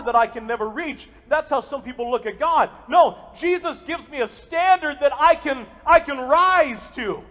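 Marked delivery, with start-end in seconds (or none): none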